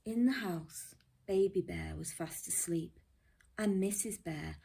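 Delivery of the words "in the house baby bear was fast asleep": ordinary delivery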